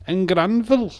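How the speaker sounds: squeaky Welsh valley caricature; over-the-top Welsh. Shown as a demonstration. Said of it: "Welsh accent".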